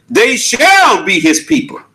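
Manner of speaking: "shall" lifted high and held; stress on shall. Shown as a demonstration. Preacher returning to the verse, stress on "shall".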